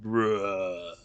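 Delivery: low, quiet groaning bruh; zombie groan. Pretend. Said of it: "Impromptu zombie impression".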